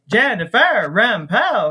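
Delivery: slow, "Jennifer Rempel" with every E stretched; mock umlaut pronunciation. Shown as a demonstration. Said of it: "That's how you pronounce it".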